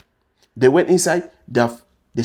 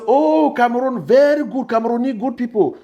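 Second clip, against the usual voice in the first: higher, bright imitation of a friendly Dubai policeman; cheery policeman; 9 semitones higher than usual. Policeman greeting a Cameroonian detainee, cheerful imitation. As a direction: play the chatty cop praising Cameroon.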